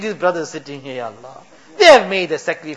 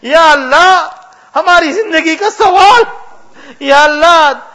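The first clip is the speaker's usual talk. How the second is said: higher and louder, crying out to God; fervent loud pleading; 8 semitones higher, 5 dB louder than usual. Real, not acted. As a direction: let the plea swell into a passionate cry. Climax of dua, impassioned crying out.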